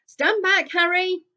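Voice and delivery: high, loud warning; dad warning Harry before the blast; warning shout. A narrator's reading. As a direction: clear the boy out of the way.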